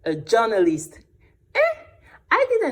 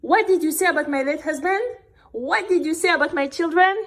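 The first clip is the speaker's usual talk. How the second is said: high, fast outraged questions; indignant mom; 9 semitones higher, faster than usual. Retold or imitated. Mom confronting Mister Diba, high and fast in outrage. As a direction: mom bristling at an insult to her family. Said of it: "And Mom said".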